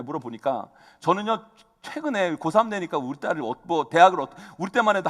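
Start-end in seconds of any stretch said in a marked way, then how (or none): none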